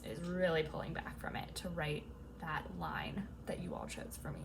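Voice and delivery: hushed unvoiced whisper. Real, not acted. Telling viewers about the line they chose, whispering. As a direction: let the words fall to a whisper. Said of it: "whispered aside".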